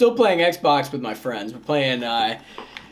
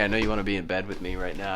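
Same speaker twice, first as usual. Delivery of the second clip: mock seductive; 7 semitones lower, 6 dB quieter, much faster than usual. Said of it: "that's so fucking cringy".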